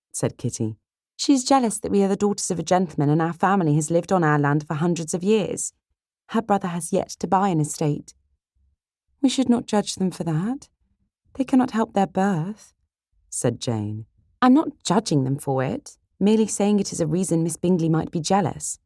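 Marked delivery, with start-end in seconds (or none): 1.2-8.1 put-on high, quick young girl's voice; lifted, opinionated little sister; indignant sister Kitty. 9.2-12.7 high-pitched gentle reproof; kindly, high-voiced objection; charitable sister Jane. 14.4-18.8 put-on higher girl's voice, justifying herself; lightly huffy young sister; defensive sister Kitty.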